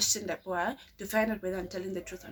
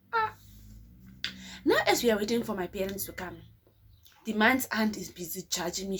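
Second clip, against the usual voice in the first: ordinary talk, nothing marked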